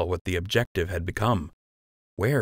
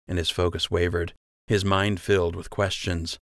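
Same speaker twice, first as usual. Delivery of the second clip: ordinary delivery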